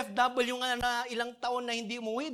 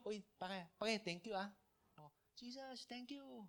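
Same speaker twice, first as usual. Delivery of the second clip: much softer, offhand little thank-you; quiet casual thanks; 13 dB quieter than usual. Retold or imitated. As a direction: mumble it like a quick thanks to a buddy. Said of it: "But he said it with a loud voice".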